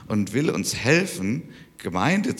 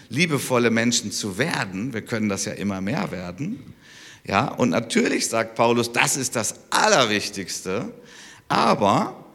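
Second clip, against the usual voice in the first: ordinary talk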